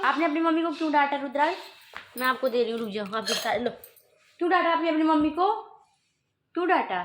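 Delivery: put-on high sing-song baby talk, scolding in fun; playful mock scolding. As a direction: tease the baby with a pretend telling-off. Mother playfully scolds her baby. (माँ बच्चे को ऊँची तोतली आवाज़ में प्यार से झूठमूठ डांटती है)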